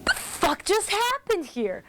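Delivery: mock bewildered, drawn-out what-the-hell; incredulous. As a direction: imitate Punk baffled in the ring. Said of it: "this incredulous look on his face".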